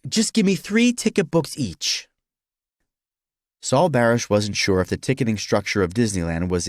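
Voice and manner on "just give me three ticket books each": high, resigned customer voice; exasperated surrender at the counter; giving in, exasperated. Acted. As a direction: give in grudgingly, pitched well up.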